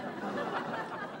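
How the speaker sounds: breathy quiet chuckle; soft laugh. Real, not acted. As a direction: laugh under your breath. Laughing at own joke.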